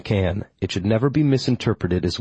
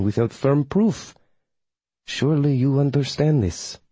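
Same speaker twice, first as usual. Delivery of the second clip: higher, carefully formal coven witch; formal witch, explaining; 5 semitones higher than usual. Acted. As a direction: reason earnestly in the witch's raised voice. Voices witch Melina explaining herself.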